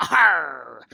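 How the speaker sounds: a loud, gruff pirate "Arr!"; pirate growl. Pretend. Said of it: "today we're gonna be a pirate".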